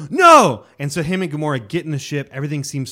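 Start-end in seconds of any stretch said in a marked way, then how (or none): 0.1-0.6 much louder, much higher cry of dismay; hammed-up horrified no; mock shout. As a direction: yell it at the screen. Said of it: "And you're like".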